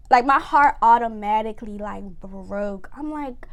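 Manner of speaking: ordinary delivery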